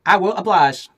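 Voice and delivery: high, loud sweet wife. Acted. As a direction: chirp it like an eager wife. Wife agrees to husband.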